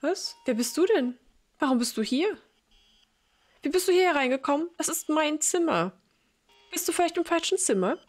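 high, rising frightened questions; alarmed old mother. Acted. Confused mother suddenly fearing the stranger who is her son, alarmed. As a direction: back away from a stranger in your room.

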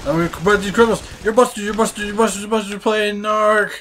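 high, excited cartoon-cop yelling; mock cop shout. Player as narc cop busting criminals, mock cop shout.

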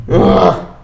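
loud groan of disgust; exasperated groan. Real, not acted. Reviewer groaning at a pointless book, exasperated. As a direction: let out a loud, fed-up "ugh".

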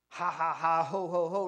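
ha-ha and ho-ho at full volume; loud mock laughter. A narrator's reading. Two pigs who think they fooled the wolf, loud laughter. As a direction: laugh heartily in character. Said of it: "laughed the two little pigs".

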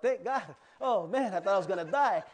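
loud, high burst of relief; acted relief. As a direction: exclaim as if just saved from drowning. Acts out relief at breathing again.

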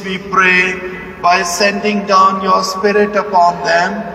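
ordinary delivery

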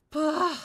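sudden loud bark of fright; loud frightened outburst; startled bah. Real, not acted. Startled in scary game.

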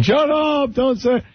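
high, mock yell; mock screaming. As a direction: screech at a spoiler. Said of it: "people are screaming".

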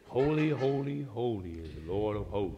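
flat, lifeless recital of "Holy, holy, holy"; mock flat reading. Mocking how the angels should not say it, flat and lifeless. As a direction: drone it out like a bored reader. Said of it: "I hope they don't say it like that".

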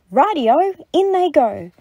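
high, loud, eager storybook cry; gleeful agreement. A narrator's reading. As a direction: sing out a chirpy go-ahead.

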